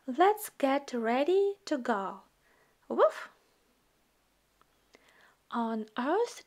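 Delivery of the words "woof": high little puppy woof; puppy bark. Acted. Voicing the puppy Biscuit barking.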